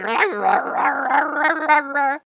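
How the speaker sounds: loud ranting nonsense; hammed-up loud yelling; mock raging. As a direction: rant it like a tantrum.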